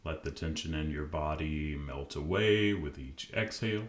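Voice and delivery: calm, unhurried meditation voice with long pauses; guided meditation. Acted. Podcast host guiding listeners to relax, calm meditation voice. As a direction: ease the listener gently into stillness.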